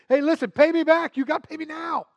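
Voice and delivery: high, loud, pushy demand; angry demand. Acted. Servant demanding money from his fellow servant, angry. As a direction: lean hard on the debtor.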